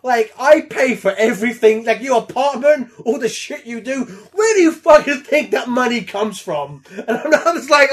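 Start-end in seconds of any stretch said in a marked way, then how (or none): 0.0-6.8 hammed-up furious dad, higher and louder; angry father. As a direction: rant at the lazy son. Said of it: "Pierce Brosnan just be like".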